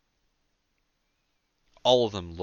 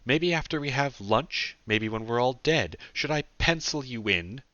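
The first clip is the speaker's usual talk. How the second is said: louder, higher, biting sarcastic questions; angry hiss; 6 semitones higher, 4 dB louder than usual. A narrator's reading. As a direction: throw sarcastic questions at him angrily. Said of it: "she hissed at him".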